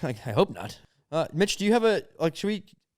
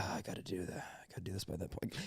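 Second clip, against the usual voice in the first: put-on hushed, whispered busy-baby voice; breathy whisper as a stressed baby with a packed calendar; baby's voice; 9 semitones lower, 19 dB quieter than usual. Friend pitching a bit, whispering as an overbooked baby. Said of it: "going into her voice and be like".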